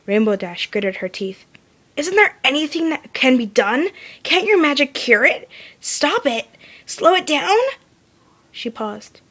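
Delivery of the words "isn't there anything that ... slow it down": desperate pleading. A narrator's reading. Dash desperate, gritted teeth.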